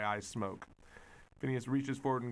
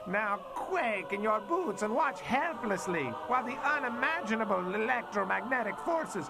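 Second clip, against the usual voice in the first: slow, higher, grandiose villain voice; gloating mad scientist; 8 semitones higher than usual. Acted. Scientist unveiling his magnifier to his foe, slow gloating villain. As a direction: savor every word of the evil plan.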